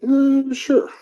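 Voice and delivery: a squeaky, very high uh sure. Retold or imitated. To the show invite, a squeaky high mock-surprised sure. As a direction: toss off a high-pitched surprised yes. Retold casual yes to show.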